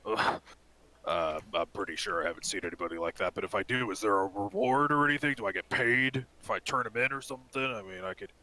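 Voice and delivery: lightly voiced half-orc barkeep, hedging; a hint of a bartender character angling for payment. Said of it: "And the half-orc goes".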